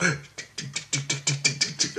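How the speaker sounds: quick, soft ticking of little feet; imitated approaching footsteps. Daughter runs back to the door, imitated pattering. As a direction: click the tongue rapidly like running feet. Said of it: "I hear her come back".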